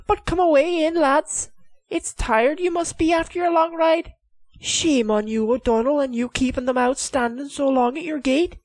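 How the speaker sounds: high, teasing lady of the castle; Lady McSweeney's voice. Acted. The lady teasing her husband, high and playful.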